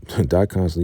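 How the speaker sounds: ordinary delivery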